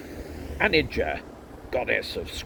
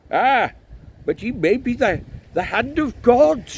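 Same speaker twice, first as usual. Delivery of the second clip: high, loud objection from the crowd; excited high-pitched heckler; heckling priest; 10 dB louder than usual. A narrator's reading. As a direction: pipe up triumphantly, high and loud.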